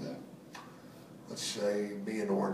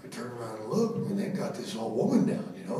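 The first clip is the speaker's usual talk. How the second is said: ordinary talk, nothing marked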